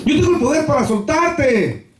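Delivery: high, strained cry of authority; imperious boast. Acted. Pilate asserts his power over Jesus, high and imperious. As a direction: throw it out high, pulling rank.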